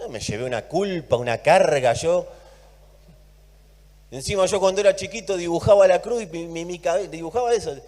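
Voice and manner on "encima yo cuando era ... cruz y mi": usual speaking voice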